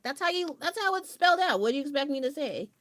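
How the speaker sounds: a huffy retort defending the spelling; hammed-up indignation; mock defensive. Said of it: "It's like".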